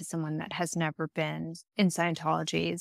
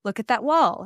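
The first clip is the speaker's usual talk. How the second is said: louder firm order to a student; firm command; 4 semitones higher, 6 dB louder than usual. Shown as a demonstration. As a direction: tell them where to look, no room to argue. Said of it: "you're giving these instructions".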